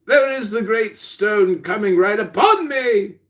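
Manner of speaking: high, theatrical alarm; hammed-up nightmare terror; panicked sleeper. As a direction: cry out in mock dread. Sleeper sees stone falling.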